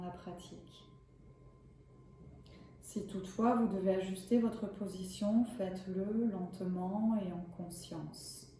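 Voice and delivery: calm, measured yoga nidra guidance; unhurried relaxation voice with spacious pauses; guided meditation. Acted. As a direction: guide them gently into rest, leaving long pauses. Leads a yoga nidra session.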